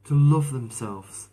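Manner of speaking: ordinary talk, nothing marked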